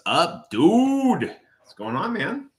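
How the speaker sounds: happy shout-out to a viewer arriving; excited greeting. Real, not acted. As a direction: greet him with a bright high call. Greeting a viewer who joined.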